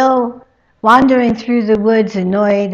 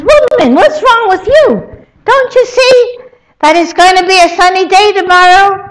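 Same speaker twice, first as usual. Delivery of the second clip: loud, much higher, fed-up retort; exasperated quarrel; 12 semitones higher, 11 dB louder than usual. Acted. First man bickering with his wife, loud and fed up. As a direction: fire it right back at her, faster at the end.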